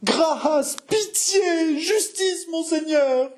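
piercingly high, theatrical begging; crying out for mercy. Acted. Jeanne falling to her knees before monseigneur, crying out for mercy. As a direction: wail each plea in desperation. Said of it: "cria Jeanne en tombant à genoux".

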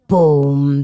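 deep, loud boom; booming footsteps. A narrator's reading. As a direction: stomp it out like a giant's step. Giant footsteps.

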